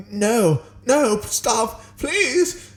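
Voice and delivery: high, pleading mock scream; trying to scream. A narrator's reading. Flitwick pleading as he turns into a cat, high panicked plea. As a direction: beg in panic. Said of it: "he tried to scream".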